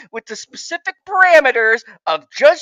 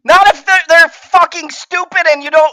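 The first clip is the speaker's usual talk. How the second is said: indignant objection; 3 semitones higher, 4 dB louder than usual. Real, not acted. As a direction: shout down the argument. Argues back at the other host.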